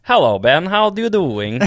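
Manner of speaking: bouncy Swedish accent, pitch swooping up; sing-song Swedish impression. Shown as a demonstration. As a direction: greet a friend in mock Swedish. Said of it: "I love your Swedish one".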